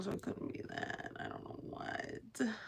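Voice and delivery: breathy whisper, trailing off. Real, not acted. Sad streamer trailing off about crying, whispering. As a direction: barely voice the words to yourself.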